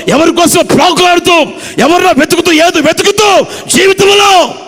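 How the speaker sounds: preacher crying out to the weeping faithful; fervent preaching. Acted. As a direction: press the questions on the congregation, climbing. Preaching at full force.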